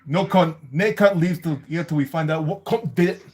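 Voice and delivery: put-on menacing Scottish accent, consonants bitten hard; Scottish accent as Begbie, bearing down on the consonants. Host reads the Begbie quote in a Scottish accent. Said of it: "if you can try to do Begbie".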